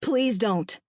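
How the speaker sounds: earnest plea with an edge of warning; urgent, restrained appeal. A narrator's reading. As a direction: plead firmly with the child. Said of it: "Lexi warned".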